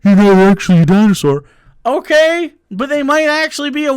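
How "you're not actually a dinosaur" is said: louder, sneering quote of the usual argument; mocking opponent's line. Mocking the opponent's stock line, louder and sneering. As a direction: parrot the tired argument back, mockingly. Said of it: "it's just the same shit every time".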